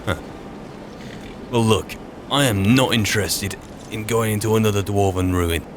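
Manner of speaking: usual speaking voice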